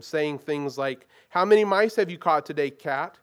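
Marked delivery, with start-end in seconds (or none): 1.3-3.2 high, loud mocking taunt; the crowd taunting the bound lion; jeering taunt. A narrator's reading. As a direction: sneer at him like a bully. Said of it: "jeering at him".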